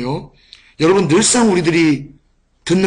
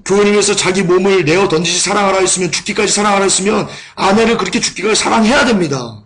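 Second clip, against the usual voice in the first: preaching; 4 semitones higher than usual. Acted. Preacher exhorting the congregation.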